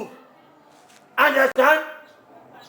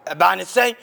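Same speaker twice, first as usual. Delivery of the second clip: ordinary talk, nothing marked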